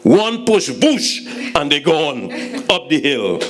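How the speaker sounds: ordinary talk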